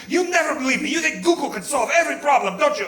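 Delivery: high, accusing mock yell; mock screaming. Mother-in-law accusing her daughter in the car, mock screaming. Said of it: "all three of us are screaming".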